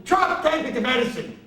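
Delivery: put-on high-pitched refusal from an old resident; cranky patient. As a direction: snap back at the nurse, high. Patient snaps back at nurse.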